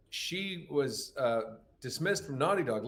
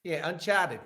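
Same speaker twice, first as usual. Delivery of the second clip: mock Australian accent; 4 semitones higher, slower than usual. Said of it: "in Australia".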